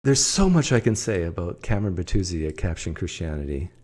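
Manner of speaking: usual speaking voice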